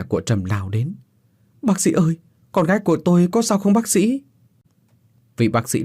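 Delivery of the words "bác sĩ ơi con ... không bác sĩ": high, frantic mother begging the doctor; cling to the doctor's answer in panic; terrified mother pleading for her daughter; desperate plea. Acted. Mother begs doctor about daughter.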